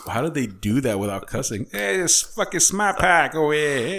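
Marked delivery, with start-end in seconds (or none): 1.7-4.0 slow, loud, high-pitched Boston accent impression; mock Boston accent. A buddy mocking the Boston commercial in a Boston accent. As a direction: ham up a thick Boston accent. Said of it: "that Boston accent".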